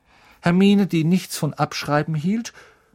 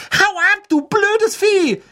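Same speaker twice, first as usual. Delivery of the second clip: very high, loud, drawn-out yell; furious shout; 7 dB louder than usual. A narrator's reading. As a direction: scream it to chase the animal off. Ron brüllt den Kater an. (wütend und gedehnt brüllend)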